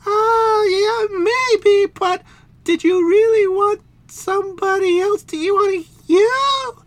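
high, loud whining princess; high shrieking princess plea. Acted. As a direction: wail it high and scolding. Princess figure voice.

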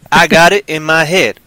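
loud put-on drawling accent. Host insisting on his programming, in a put-on accent. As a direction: lean hard into a put-on accent. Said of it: "why am I going with that accent".

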